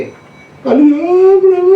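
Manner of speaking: high wailing cry of अरे प्रभु, breaking into a sob; hammed-up crying to the Lord; mock wailing cry. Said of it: "करके रोते रहेगा, वैसा नहीं". (नकली रोते हुए पुकार)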